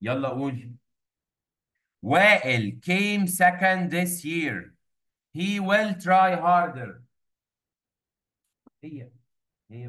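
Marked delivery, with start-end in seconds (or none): 2.0-7.0 each word stretched out very slowly; slow dictation. Shown as a demonstration. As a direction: dictate it so they can write along. Teacher dictating sentences.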